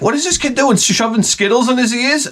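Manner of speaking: high, incredulous mock question; fed-up disbelief at the ear infections; mock-baffled father; exasperated. Exasperated wondering about the kid.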